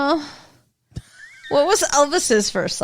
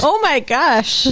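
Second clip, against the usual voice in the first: 1930s prim voice; 3 dB louder, slower than usual. Pretend. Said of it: "you're from the thirties".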